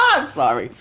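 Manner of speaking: laughs, then apologizes; laughing apology. Real, not acted. Reader laughing at own slip, sorry. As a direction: giggle out a sorry.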